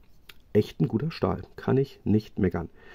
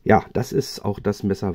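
ordinary talk

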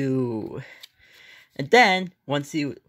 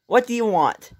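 normal talking voice

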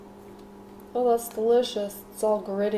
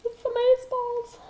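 much higher squealing fan voice; gushing fans. Retold or imitated. Fans begging her to keep cooking, high squeal. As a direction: gush like a starstruck fan. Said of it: "And they'll be like".

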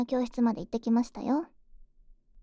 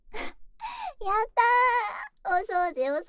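cheering; 9 semitones higher, slower than usual. Acted. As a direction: cheer with joy. Cheering at permission.

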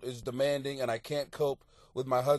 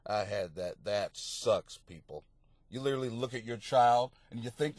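usual speaking voice

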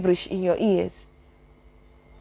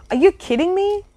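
incredulous; 9 semitones higher than usual. Real, not acted. Rhetorical disbelief to viewers.

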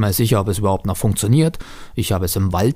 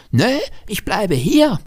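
put-on high-pitched stubborn little boy; stubborn child; 12 semitones higher than usual. A narrator's reading. Child refusing to go back to car, stubborn high voice. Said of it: "Lennart stellt sich stur".

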